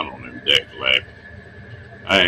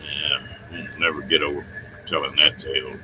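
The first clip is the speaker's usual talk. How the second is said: ordinary talk, nothing marked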